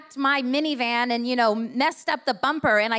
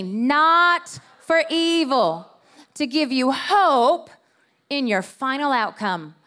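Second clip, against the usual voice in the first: every word drawn out slow; slow emphatic scripture; 5 dB louder, much slower than usual. Shown as a demonstration. Pastor leading the church in a slow reading. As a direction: lead the room through it slowly. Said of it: "Say that with me".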